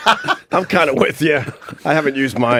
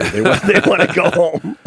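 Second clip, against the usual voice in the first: words broken by a laugh; 5 semitones higher, 3 dB louder than usual. Real, not acted. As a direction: laugh through the line. Host laughing through remark.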